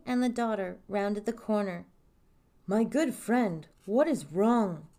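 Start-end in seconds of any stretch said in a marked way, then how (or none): 2.7-4.9 slow, stately king; measured royal delivery; kingly voice. A narrator's reading. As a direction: speak as a concerned monarch, taking your time. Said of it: "said the king".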